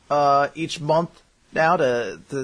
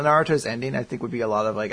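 ordinary delivery

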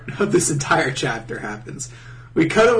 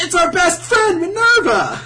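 high, loud mock-cheering crowd; gushing welcome; 6 dB louder than usual. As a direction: shout an over-the-top welcome. Guild's gushing welcome, mocked.

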